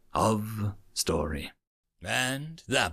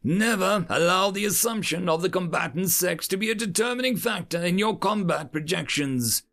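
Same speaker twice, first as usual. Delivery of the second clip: lightly voiced, higher-pitched instructor; lecturing educator; 6 semitones higher than usual. A narrator's reading. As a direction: warn the class as their teacher.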